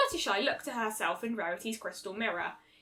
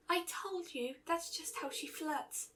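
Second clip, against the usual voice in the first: soft, shy and slow; quiet; 5 semitones higher, 9 dB quieter, slower than usual. A narrator's reading. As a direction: barely voice it, timid. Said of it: "Fluttershy said quietly".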